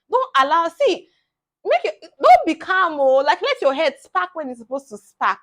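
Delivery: loud, high, emphatic exhortation; fired-up urging. Real, not acted. Urging students to stand up for themselves, loud and fired-up. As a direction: raise your voice to rouse the listener. Urging viewers not to be meek.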